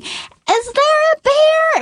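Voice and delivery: small son asking his parents about the bear; curious little boy. Retold or imitated. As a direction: ask it like a wide-eyed toddler. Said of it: "our son, who's looking around going".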